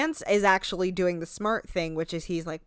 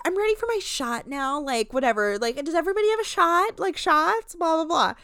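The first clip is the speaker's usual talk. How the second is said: higher, louder, fast tipsy whine; imitating a tipsy reality star calling for shots; mock drunk diva; 9 semitones higher, 4 dB louder than usual. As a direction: play a drunk woman demanding shots. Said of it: "She's like".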